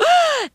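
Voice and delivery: high, gleeful jeering laugh; mocking laughter. Friends laughing at Horton, mocking laughter. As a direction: laugh high at his expense. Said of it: "They laughed and they laughed".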